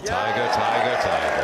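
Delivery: loud, thrilled cheering of the name; excited cheer. Real, not acted. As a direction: shout his name in delight. Cheering a great shot.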